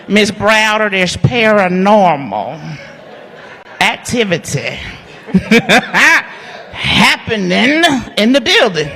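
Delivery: slow, drawn-out paranormal with laughter breaking in; mock his solemn ghost warning, cracking up; imitating the owner. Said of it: "I gotta do it like he did it".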